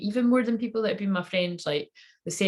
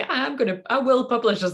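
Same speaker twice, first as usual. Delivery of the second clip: bold declaration; 7 semitones higher, 5 dB louder than usual. Retold or imitated. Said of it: "I was gonna say".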